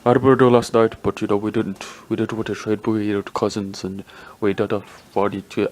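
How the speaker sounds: mock foreign-accented executive, garbled and bumbling; Vlade Divac. Team executive excusing the Cousins trade, mock foreign accent. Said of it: "was talking about".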